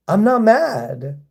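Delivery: normal talking voice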